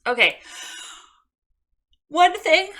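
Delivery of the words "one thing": words stretched through a real yawn, pitched high; a yawn swallowing the words. Real, not acted. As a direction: talk right through a big yawn. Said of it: "I'm so sorry I'm yawning".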